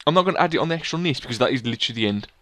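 usual speaking voice

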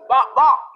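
loud double jab noise; punch sound effects. Retold or imitated. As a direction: land two punches with the voice. Imitates a two-piece punch.

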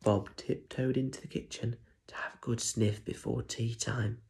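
usual speaking voice

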